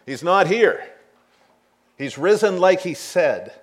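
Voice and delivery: joyful laughing announcement. Real, not acted. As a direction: burst out with joy at the news.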